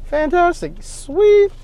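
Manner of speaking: high-pitched, pleased exclamations; light up at the lucky find; mock-giddy high voice; delighted. Delighted at finding Lowe's.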